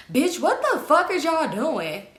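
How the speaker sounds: high, drawn-out demand of what's going on; indignant confrontation. Retold or imitated. Friend walks up on the couple making out, indignant. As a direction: imitate a friend calling them out in disbelief.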